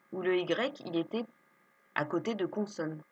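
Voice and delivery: ordinary delivery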